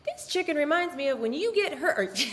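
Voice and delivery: cheesy southern drawl, high and loud; hammed-up southern drawl breaking toward laughter; mock a husband's folksy southern ad voice. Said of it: "In his southern drawl".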